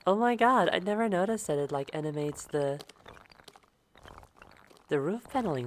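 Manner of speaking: loud, high excited outburst. Real, not acted. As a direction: gush with sudden delight. Amazed by animated roof.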